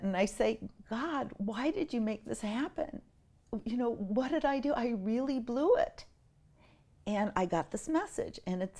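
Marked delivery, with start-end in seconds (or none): 0.9-6.1 soft, tearful plea to God; a touch of crying. Retold or imitated. Crying mother asks God why, soft and tearful. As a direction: murmur it through tears. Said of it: "I'm still kind of crying".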